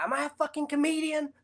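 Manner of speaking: high, tense mobster snarl thrown out; menacing gangster quote. Retold or imitated. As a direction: play the touchy gangster daring anyone to laugh. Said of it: "and he's like".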